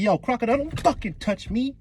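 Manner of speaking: high-pitched indignant warning; hammed-up defiant protest; standing up for himself. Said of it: "I wish Buggy would stand up for himself".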